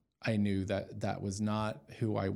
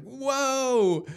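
a much higher, louder, stunned whoa; awed whoa; 7 dB louder than usual. Retold or imitated. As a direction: gasp it out, overwhelmed by awe. Retelling being flooded again.

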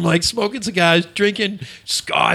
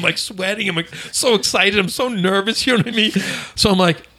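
ordinary talk

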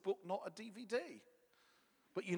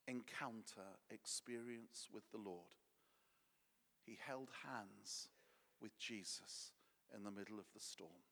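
hushed sermon; 7 semitones lower, 5 dB quieter than usual. Acted. Preacher slows to intimate hush.

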